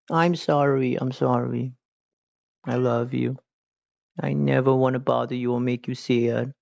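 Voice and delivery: high, sweet talk to a pet; baby talk to cat. Pretend. Streamer cooing apologies to her cat, high pet voice. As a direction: coo an apology to the kitty.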